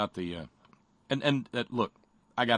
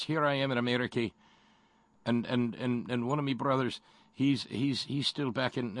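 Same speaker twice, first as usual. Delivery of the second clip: Irishman voice; 4 semitones higher than usual. Retold or imitated. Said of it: "And he says".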